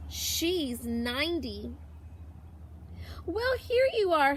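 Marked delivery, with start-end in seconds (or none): 3.2-4.3 put-on sudden high, louder old lady greeting; lightly voiced old woman, pleased to see them; a hint of a delighted elderly neighbour; Missus Baker. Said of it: "Missus Baker".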